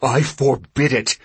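loud, slow forbidding command; enraged patriarch, each word weighted; furious father. A narrator's reading. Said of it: "His father's breath was deep and loud".

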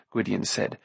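ordinary delivery, nothing marked

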